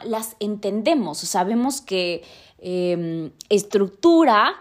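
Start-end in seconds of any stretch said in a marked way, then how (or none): 3.5-4.5 estructura said slowly and louder, each syllable spelled out; slow, stressed word. Shown as a demonstration. Showing listeners how a new word is worked out, estructura slow and loud. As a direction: lean on estructura and stretch it. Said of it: "tiene alguna relación con estructuralismo".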